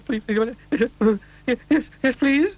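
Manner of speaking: high, trembling rapid yeses; a shaking little man begging eagerly; quivering. A narrator's reading. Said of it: "quivered and shook and said".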